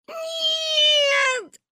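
high, drawn-out tearful wail; crying child. A narrator's reading. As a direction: wail like a crying little girl. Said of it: "плакала Маня". (плача, высоко и протяжно)

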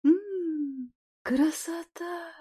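soft, slow, blissful murmur; blissful sigh. Acted. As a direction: melt with pleasure. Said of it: "блаженно закатывая глаза".

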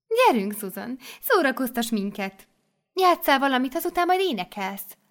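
high, loud coaxing abbess voice; bossy mother superior. Acted. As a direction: rouse the new girl like a cheerful abbess. Mother superior urges Susan.

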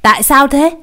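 high, loud shocked question; a hint of a different man's voice, shocked; stunned question. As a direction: blurt it out in shock. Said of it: "ông bàng hoàng".

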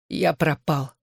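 drugged, thick-tongued mumbling; woozy patient slurring; slurred speech. A narrator's reading. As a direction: let the words loll on a clumsy tongue. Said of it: "заплетающимся языком произнёс Джонни".

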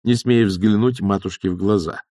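usual speaking voice

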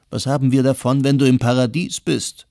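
wife sobbing at her husband's talk of paradise. A narrator's reading. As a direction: hurl it back at him through tears. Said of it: "schluchzte Nermin".

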